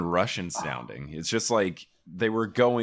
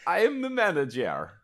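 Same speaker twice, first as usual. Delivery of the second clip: much higher, louder fake Russian accent; mock Russian manager; 12 semitones higher, 4 dB louder than usual. Mocking the manager's fake Russian accent. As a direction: overblown fake Eastern European accent. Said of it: "That is not a name".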